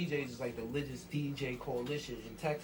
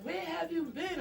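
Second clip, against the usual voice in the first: high mock woman's voice, surprised; imitating Megan. A friend surprised to hear from Max, high mocking voice. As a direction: squeak it out like a startled friend. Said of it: "She was like".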